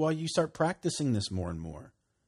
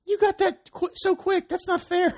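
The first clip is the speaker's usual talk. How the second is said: mock indignation; 3 dB louder than usual. Mock complaint at buddy's speed.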